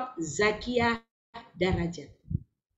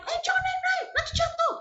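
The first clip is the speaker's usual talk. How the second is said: sharp alarmed scold. Retold or imitated. Grandmother scolding climbing grandchild.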